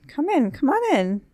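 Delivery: sing-song high call to the door. Real, not acted. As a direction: lift the pitch to call someone in. Calling to someone at the door.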